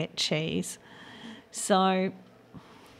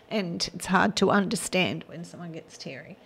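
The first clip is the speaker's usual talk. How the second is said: usual speaking voice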